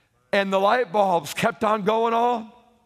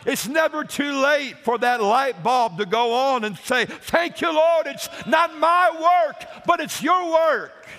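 a louder, higher outpouring of thanks to the Lord; grateful exclamation; 5 semitones higher, 4 dB louder than usual. Acted. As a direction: cry it out like a newly enlightened believer. Voicing a believer thanking God.

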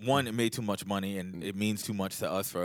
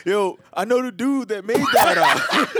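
put-on high, loud laughing kid boast; imitating his younger self, laughing; child voice; 12 semitones higher, 10 dB louder than usual. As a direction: brag like an excited little kid. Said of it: "My dad would always tell me the story".

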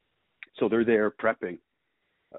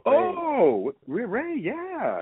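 sudden recognition; much slower than usual. Real, not acted. Recognising the friend.

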